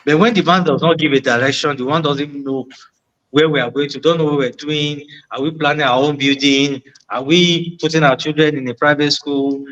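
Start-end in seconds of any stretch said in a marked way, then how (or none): none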